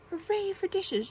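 high, loud little cheer; cheering imp. A narrator's reading. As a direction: cheer it out in delight. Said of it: "cheered the imp".

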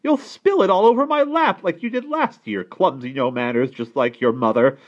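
high, loud, outraged aunt; shouted scolding. A narrator's reading. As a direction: scold the clumsy child at full voice. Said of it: "shouted Aunt Agra".

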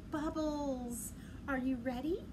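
ordinary delivery

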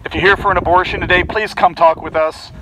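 normal talking voice

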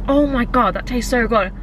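ordinary delivery

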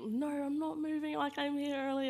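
a put-out woman digging in; stubborn refusal. Retold or imitated. Woman in cinema refuses to move seats, huffy.

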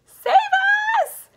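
high, drawn-out cry for help; terrified cry. A narrator's reading. Grandma Josephine as the roof falls in, terrified cry. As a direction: wail it out in panic. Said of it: "cried Grandma Josephine".

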